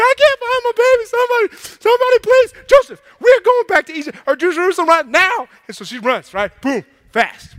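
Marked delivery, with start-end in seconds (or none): 0.0-5.5 high, loud, urgent pleading; hammed-up frantic mother. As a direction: rush it out in high-pitched panic. Mary frantic, demands going back.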